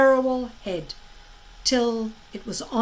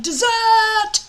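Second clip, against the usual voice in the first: dragon's cry; 10 semitones higher, 8 dB louder than usual. A narrator's reading. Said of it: "Fireworm's cry of".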